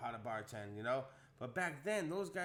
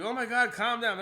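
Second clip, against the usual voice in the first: loud, high panicked "calm down"; freaked-out plea; 8 semitones higher, 10 dB louder than usual. Retold or imitated. T Murder begging him to calm down, loud high panic. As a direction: friend begging him to calm down, much higher and louder. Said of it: "He's bugging out".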